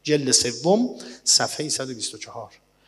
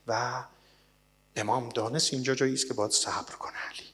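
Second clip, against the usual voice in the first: normal talking voice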